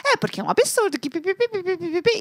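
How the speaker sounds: high mock rant, words replaced by pipipi; retold angry Portuguese rant as pipipi; blah-blah mock rant. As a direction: rattle on like scolding a dog. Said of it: "Tipo assim".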